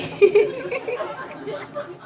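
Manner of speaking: a soft giggle up high. Real, not acted. Wife giggles about the Quran verse, high and soft.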